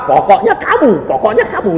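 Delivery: high, insistent mock scolding; notaris ngotot. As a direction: play the stubborn notary pinning blame. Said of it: "notarisnya ngotot". (menirukan notaris yang ngotot)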